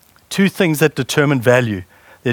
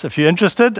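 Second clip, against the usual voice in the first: ordinary talk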